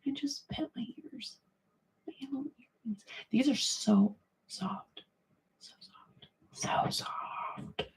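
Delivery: soft unvoiced whisper, slowing down; hushed cooing whisper. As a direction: breathe it out while stroking the earrings. Whispering while petting earrings.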